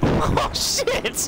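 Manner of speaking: high, breathy shocked cry; startled laughing yelp. Real, not acted.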